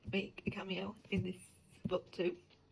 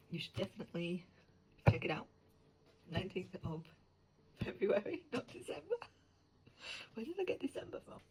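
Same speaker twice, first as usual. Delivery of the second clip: normal talking voice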